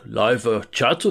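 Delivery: puzzled, careful echo; Kiryu, puzzled. Retold or imitated. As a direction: sound out an unfamiliar term. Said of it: "Kiryu's like".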